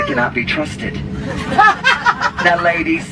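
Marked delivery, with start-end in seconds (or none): 1.1-2.6 excited squeal. Acted. Sudden high exclamation with laugh.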